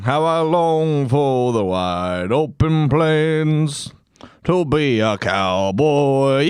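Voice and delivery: slow, dreamy, longing sigh; hammed-up wistful longing, drawn out; mock yearning. Cowboy yearning parody intro.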